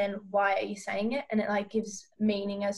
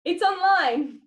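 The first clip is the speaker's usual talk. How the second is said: high, loud exaggerated exclamation; maximum level; 8 semitones higher, 7 dB louder, slower than usual. Shown as a demonstration. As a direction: push the line to the maximum, pitched high. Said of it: "we had it to the maximum level".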